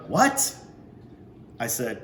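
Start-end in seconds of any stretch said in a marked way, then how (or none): 0.1-0.5 sudden high, loud "What?"; shouted. Acted. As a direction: bark it out in surprise. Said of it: "The man shouted".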